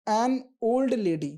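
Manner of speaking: put-on "an old lady" said very slowly; slow example. Shown as a demonstration. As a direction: stretch each word for the class. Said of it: "हमारे articles कहाँ हैं".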